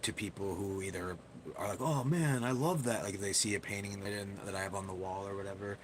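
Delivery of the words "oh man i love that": excited. Retold or imitated. People admiring his painting.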